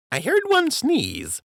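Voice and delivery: put-on high, playful old dockhand voice; high-voiced dockhand cracking a joke; joking dockhand. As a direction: tease the captain, pitched high.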